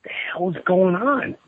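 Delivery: breathy disbelieving whisper; a retold stunned whisper. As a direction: hush it out in shock. Stunned reaction retold on vacation.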